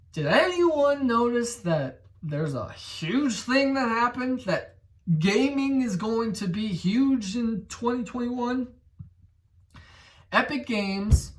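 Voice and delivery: excited. Real, not acted. Hyping the big news.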